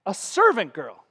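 ordinary talk

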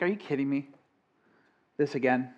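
quiet, tired "Are you kidding me?"; weary disbelief. Shown as a demonstration. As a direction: sigh it out, fed up. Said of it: "you can say it a lot of different ways".

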